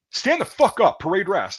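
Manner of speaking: high, loud shouted command; Marine drill instructor. Retold or imitated. Marine calling out the guilty recruit, shouting orders. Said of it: "the Marine, he goes".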